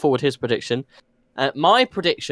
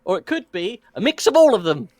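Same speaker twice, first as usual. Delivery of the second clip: ordinary talk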